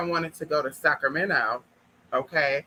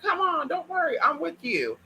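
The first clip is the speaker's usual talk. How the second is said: friend reassuring; 11 semitones higher than usual. Retold or imitated. Friend reassures her, high voice.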